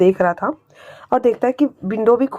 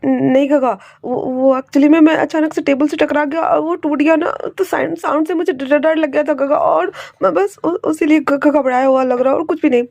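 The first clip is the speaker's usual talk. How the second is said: higher stammering, shaken; scared stammer; 5 semitones higher than usual. Acted. Wing tells his uncle the noise scared him, stammering high. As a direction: stutter through the excuse, rattled.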